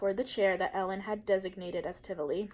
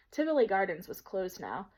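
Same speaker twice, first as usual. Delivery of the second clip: ordinary delivery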